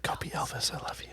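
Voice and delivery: intimate imitated whisper; retold whisper. Mother sending son off, imitated whisper. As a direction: breathe it into someone's ear, mimicking. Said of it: "whispers into his ear".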